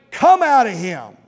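loud, slow, firm order; forceful rebuke to a demon; stern command. Acted. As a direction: cast it out sternly, weighting each word. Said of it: "Jesus said sternly".

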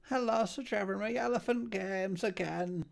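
high, prim little butler voice; Cecil's voice. Acted. Fussy butler greets his master, high voice. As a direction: chirp it up as the fussy servant.

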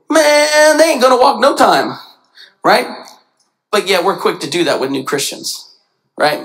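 normal talking voice

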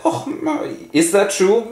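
high mock-innocent gasp; innocent girl. Ophelia feigns innocence to her father, high and sweet. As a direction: play dumb sweetly.

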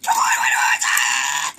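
drawn-out, strained yell; urging shout. Real, not acted. Player urging on the game, drawn-out shout. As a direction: yell it slowly, stretching the words.

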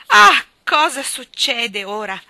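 loud, slow, high-pitched exclamation; exasperated cry. A narrator's reading. Mother hearing a crash upstairs, exasperated cry. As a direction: wail it out, drawn and loud.

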